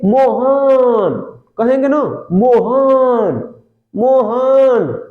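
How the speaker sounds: slow, stretched call of Mohan across a distance; calling from far. Shown as a demonstration. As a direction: drag out Mohan with the last vowel held long, as to someone far off. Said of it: "एकदम दूर है".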